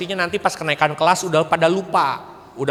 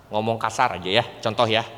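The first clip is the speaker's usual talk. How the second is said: ordinary delivery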